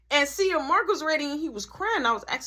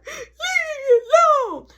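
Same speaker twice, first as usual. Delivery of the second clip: put-on high, slow, loud wailing child voice; crying kid; 7 dB louder than usual. Little brother sobbing at his siblings, high wail. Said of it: "He like".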